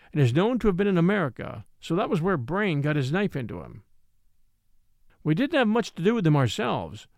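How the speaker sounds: ordinary delivery, nothing marked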